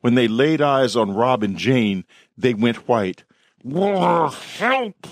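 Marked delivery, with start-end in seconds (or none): 3.6-5.1 high, growled plea; werewolf growl. A narrator's reading. Werewolf Rob begs the officers, growling high. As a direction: let the word come out as a beast's roar. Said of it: "Rob said".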